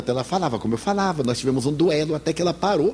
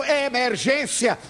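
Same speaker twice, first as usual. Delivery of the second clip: a mild mock yell, a bit higher and slower; retold shout; 7 semitones higher than usual. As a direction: call out the emergency, only half-raised. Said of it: "Quando ele gritou".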